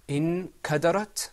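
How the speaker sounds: "انكدرت" loud and high, n hummed into the k; practice model. Shown as a demonstration. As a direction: recite "انكدرت" loudly with a hidden n. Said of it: "let's practice that word".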